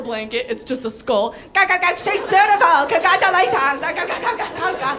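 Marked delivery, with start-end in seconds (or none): 1.5-3.8 high babbling mock baby voice, louder; skull baby. 3.8-4.9 put-on baby babble.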